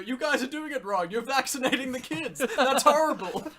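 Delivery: hammy outraged comic voice; Jim Carrey impression. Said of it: "He's trying to go".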